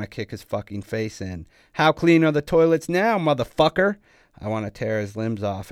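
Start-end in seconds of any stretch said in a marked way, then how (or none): 1.7-4.0 high, very loud enraged shout; full-force mocking rage; furious taunt. A narrator's reading. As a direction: yell the question down at a beaten enemy. Enraged attacker taunting his victim again.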